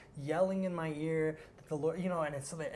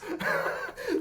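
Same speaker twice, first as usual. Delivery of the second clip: real laugh; 7 dB louder than usual. Real, not acted. Laughing at own story.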